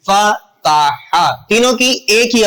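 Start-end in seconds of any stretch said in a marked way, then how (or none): 0.1-1.4 slow "فتح" as faa, taa, haa; syllable-by-syllable pronunciation. Shown as a demonstration. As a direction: stretch "فتح" into three matching a sounds. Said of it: "اس کی آواز نکلے گی".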